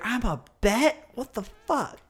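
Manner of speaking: high, drawn-out incredulous outrage; imitating someone indignant; mock pissed off. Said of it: "she gets pissed off".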